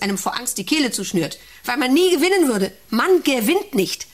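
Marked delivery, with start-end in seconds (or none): 1.6-4.1 high and loud, hammering it home; rising outburst. Acted. Director lecturing the worker, voice bursting out loud. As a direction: let the despair burst out.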